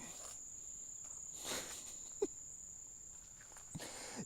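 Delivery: long high unvoiced laugh; long breathy laugh. Real, not acted. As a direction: chuckle breathily at it. Laugh at unlabeled item.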